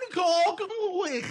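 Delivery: high, put-on Welsh lilt on "Gogwych"; a showy Welsh-accented "Gogwych"; Welsh pronunciation. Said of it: "I love it when you do that".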